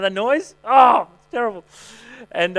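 usual speaking voice

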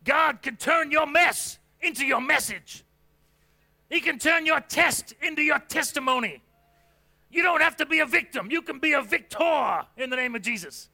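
quoting T D Jakes to the congregation, full-voiced preaching; preaching the quote. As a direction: belt it out like a revival preacher. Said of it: "the quote from T D Jakes".

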